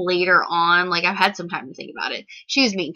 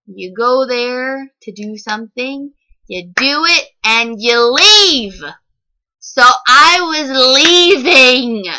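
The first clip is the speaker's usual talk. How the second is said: each word slowed and pressed louder; slow emphatic point; 2 semitones higher, 8 dB louder, slower than usual. Real, not acted. To the viewers, why she was leaving, slow and emphatic. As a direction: drive the point home word by word.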